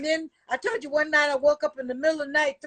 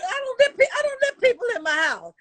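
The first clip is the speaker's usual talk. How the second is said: excited; 8 semitones higher than usual. Real, not acted. Mom exclaiming about privacy.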